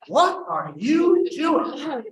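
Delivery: high, very slow, stretched-out demand; outraged date. Retold or imitated. Date confronts the man reaching over with napkin, drawn-out high outrage. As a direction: stretch each word in shocked protest. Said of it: "And Margaret goes".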